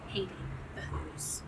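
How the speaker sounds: hissed whisper. A narrator's reading. Narrator whispering the Whos with the Grinch's disgust. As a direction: spit it out in a whisper.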